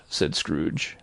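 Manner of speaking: ordinary delivery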